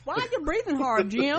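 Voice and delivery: high, loud mock suspicion; mock questioning. Acted question from the imagined boss.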